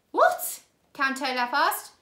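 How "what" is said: high loud outraged yelp. A narrator's reading. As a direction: spit out the shock. Olaf outraged.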